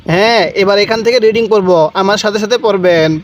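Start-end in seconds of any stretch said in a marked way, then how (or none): none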